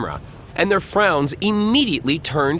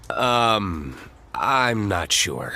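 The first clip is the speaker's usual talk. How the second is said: hesitant; 6 semitones lower, much slower than usual. Acted. Nervous cameraman hesitates.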